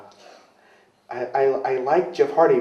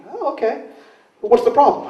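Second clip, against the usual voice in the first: wife's voice; 12 semitones higher, 3 dB louder than usual. Retold or imitated. Wife voiced on the phone.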